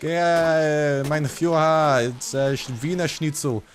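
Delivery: high, hammed-up German-Russian hybrid accent; Russian-tinged German accent. Shown as a demonstration. Gamer showing off his German accent to viewers, high and exaggerated German accent. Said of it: "my Russian accent doing a German accent".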